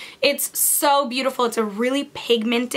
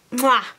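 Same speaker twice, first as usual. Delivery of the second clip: kiss sound. Real, not acted. Vlogger admiring her eye look, kiss sound. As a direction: blow a big kiss.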